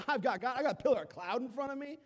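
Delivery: loud, high, swaggering brag; pumped boast. Acted. Freed Israelites bragging as they leave Egypt, loud and high. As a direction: chest puffed out, crowing.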